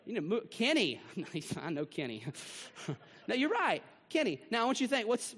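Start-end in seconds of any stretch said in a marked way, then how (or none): none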